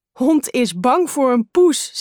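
put-on like a boy who can't believe it; astonished, drawn-out exclamation; surprised. A narrator's reading. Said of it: "zegt broer verbaasd".

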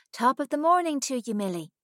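high, loud Irish lilt; Alice mimicking Millie's Irish accent. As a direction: put on a cheery Irish accent. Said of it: "a pretty good imitation of Millie's Irish accent".

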